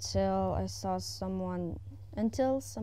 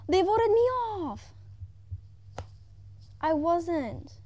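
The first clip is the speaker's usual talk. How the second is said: high, loud indignant disbelief; squealing outraged protest; shocked outcry; 8 semitones higher, 4 dB louder, slower than usual. Real, not acted. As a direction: cry out at the injustice. Ejected by vote in game.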